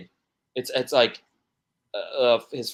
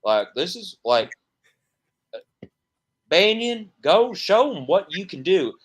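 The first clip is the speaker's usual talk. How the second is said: lightly imitated Southern drawl, louder and higher at the end; a mild Southern preacher dad calling his son up; a hint of Southern drawl, rising to a shout; 4 semitones higher, 3 dB louder than usual. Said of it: "got the Southern drawl".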